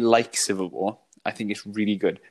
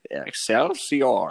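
Stan Lee; 3 semitones higher, much slower than usual. Retold or imitated. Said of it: "the scene with Stan Lee is brilliant".

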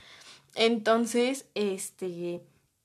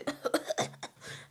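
breathy laugh; 5 semitones higher, 8 dB quieter than usual. Real, not acted. Laughing while retelling the fake choking.

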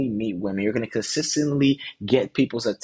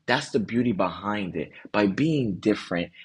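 ordinary talk, nothing marked

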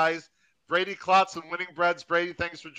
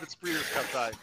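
ordinary talk, nothing marked

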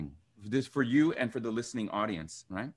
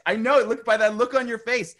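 excited; 10 semitones higher, 10 dB louder than usual. Real, not acted. Emphatic knowing exclamation.

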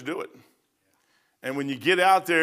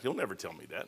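much softer and lower, muttered to himself; quiet aside; 8 semitones lower, 13 dB quieter, faster than usual. Retold or imitated. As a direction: drop it to a private mumble. Repeats the line twice.

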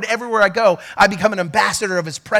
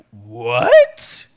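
shocked disbelief; 7 semitones lower, 5 dB louder than usual. Retold or imitated. Pastor retelling his shock at the mentor's rebuke, squealing in disbelief. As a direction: squeak it out like you can't believe it. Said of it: "And I was like".

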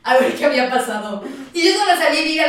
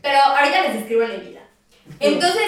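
ordinary talk

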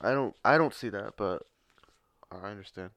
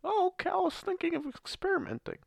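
put-on high, sweet old lady voice. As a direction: mimic a little old lady. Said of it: "this old lady comes up to me".

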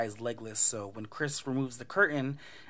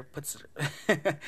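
ordinary talk, nothing marked

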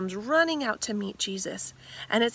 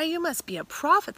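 higher, louder rising awe; the woman realizing Jesus is a prophet, higher amazed voice; amazed realization; 9 semitones higher, 3 dB louder than usual. Acted.

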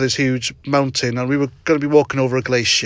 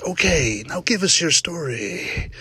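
softer, slow, gentle invitation like a calm host; presenter's soft voice; 5 dB quieter, slower than usual. Retold or imitated. Podcaster mimics the Dogman show host prompting guests, softly and slowly. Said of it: "like that".

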